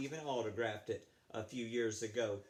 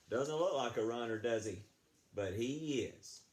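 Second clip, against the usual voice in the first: plain reading voice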